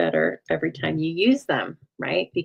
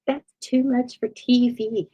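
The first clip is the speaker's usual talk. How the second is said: high, slow Southern drawl; grandma Capert's Southern accent; 6 semitones higher, slower than usual. Retold or imitated. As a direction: imitate a Southern woman's drawn-out, higher-pitched speech. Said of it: "That's my grandma, but she always had a little Southern accent".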